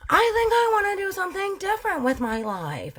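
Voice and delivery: light high wife voice, a bit dreamy; lightly voiced wife announcing a new plan; voicing Martha.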